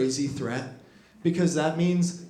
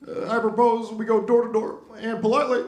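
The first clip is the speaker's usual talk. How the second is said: much higher, louder measured orator voice with pauses; Obama impression; 9 semitones higher, 5 dB louder than usual. Obama proposing a harsh policy in his calm oratory. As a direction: deliver it like a smooth statesman. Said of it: "Obama could be like".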